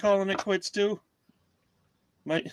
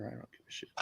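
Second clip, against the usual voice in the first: muttered under breath; 21 dB quieter, much faster than usual. Real, not acted. Muttered aside during screen share.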